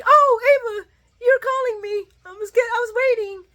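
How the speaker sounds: high, loud, overjoyed gush; happy Austin. Retold or imitated. Austin thrilled that Ava is calling him, high gushing. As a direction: gush like a giddy lover. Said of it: "Austin's all happy".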